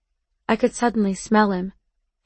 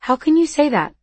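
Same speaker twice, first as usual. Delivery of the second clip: higher-pitched, hurt disbelief; acted protest, pitched up; incredulous protest; 6 semitones higher than usual. A narrator's reading. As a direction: challenge it in disbelief. Lover protests in acted argument.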